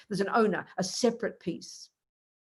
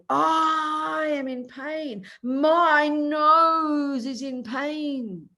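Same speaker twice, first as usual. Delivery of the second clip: very slow, higher, drawn-out moan; mock complaint; 6 semitones higher, 3 dB louder, much slower than usual. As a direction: stretch each word like a sulky sufferer. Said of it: "Now, we will say".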